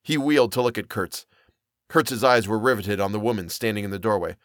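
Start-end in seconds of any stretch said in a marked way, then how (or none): none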